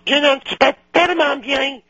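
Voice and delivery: high falsetto teacher voice; French teacher impression. Recalling the French teacher's old remark, high falsetto teacher impression. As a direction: mimic the teacher in a squeaky high pitch. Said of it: "She used to go".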